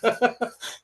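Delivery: high laugh bursting out; burst of laughter. Real, not acted. Scoffing at a rival's words, a real burst of laughter.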